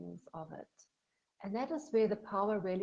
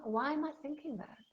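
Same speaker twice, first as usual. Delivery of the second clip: calmly curious, with a lifted high question; 7 semitones higher than usual. Shown as a demonstration. Coach shows curious self-questioning, calm and open. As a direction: ask it with gentle curiosity. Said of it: "listen to them with curiosity".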